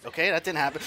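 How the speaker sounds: ordinary delivery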